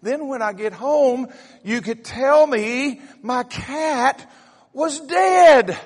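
slow, high, building to a loud cry; exasperated wife. Retold or imitated. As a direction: draw it out, then belt the last word. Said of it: "and said".

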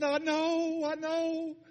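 high, loud, excited agreement; overjoyed. Retold or imitated. New believer answers congratulations, overjoyed. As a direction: imitate a thrilled new believer.

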